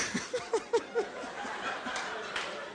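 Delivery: quiet unvoiced laughing fit; breathy laugh. Real, not acted. Preacher cracks up at his own joke, soft laugh. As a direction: let the laugh escape softly.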